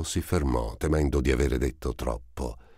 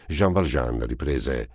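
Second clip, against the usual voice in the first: ordinary delivery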